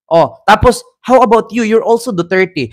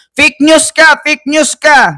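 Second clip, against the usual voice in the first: high-pitched mocking accusation; hammed-up high heckling; mock DDS accusers; 7 semitones higher, slower than usual. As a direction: jeer like an angry political supporter, pitched high. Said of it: "sasabihin na naman Duterte ng mga D D S".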